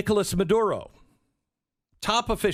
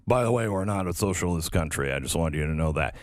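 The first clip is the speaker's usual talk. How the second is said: lower, fast, offhand put-on voice; Swedish prime minister; 10 semitones lower, faster than usual. Host mocking the DSA voices Sweden's prime minister, lower and fast, offhand. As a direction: drop the voice low and toss the words off quickly as the prime minister. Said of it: "who's like".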